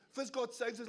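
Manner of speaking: ordinary talk, nothing marked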